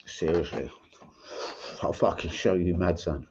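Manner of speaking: low, quiet, contained insults; calm threat. Real, not acted. YouTuber answering the man who mocks him, menacingly calm. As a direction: keep the menace calm and soft. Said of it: "That's me, calm".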